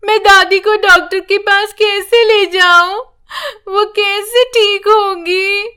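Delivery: put-on a lost little girl praying through tears; crying prayer. A narrator's reading. Granddaughter alone prays for her sick grandma, tearful. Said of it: "वो अकेली बैठ रो रही थी।".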